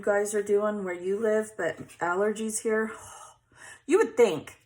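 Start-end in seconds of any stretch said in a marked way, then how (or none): none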